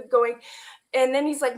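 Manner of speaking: ordinary delivery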